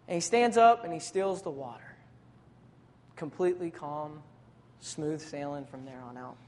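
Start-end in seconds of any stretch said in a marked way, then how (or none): none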